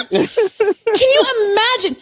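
high, laughing disbelief; can't believe it, laughing; incredulous laughing. Real, not acted.

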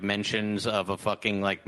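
ordinary talk, nothing marked

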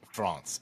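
"France" with an open ah, as "Frahnce"; "France" rhyming with "nonce"; affected pronunciation. Shown as a demonstration. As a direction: quote "France" with the broad ah. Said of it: "how many people actually say France?".

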